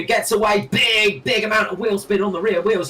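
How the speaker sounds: excited. Real, not acted. Restart launch, wheel spin.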